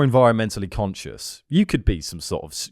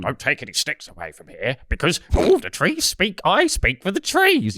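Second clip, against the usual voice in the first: Lorax voice; 6 semitones higher than usual. Retold or imitated. Mock Lorax warning a walker off sticks. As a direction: play a fussy little tree guardian. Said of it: "I might stumble on you just going".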